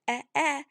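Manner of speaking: eh, eh as a short lax vowel; letter sound e. Shown as a demonstration. Teacher gives the elephant's baby sound, short eh. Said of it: "baby sound is eh".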